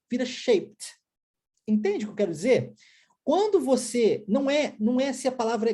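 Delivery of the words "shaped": "shaped" in one beat ending in pt; final D added. Shown as a demonstration. Teacher shows past form, "shaped" one syllable, t ending. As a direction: add a t sound to "shaped" without extra syllable. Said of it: "Quando eu coloco o D".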